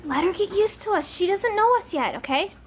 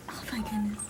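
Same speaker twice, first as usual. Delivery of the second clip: whispering; 8 semitones lower, 10 dB quieter than usual. Real, not acted. Sees the kitten in dark.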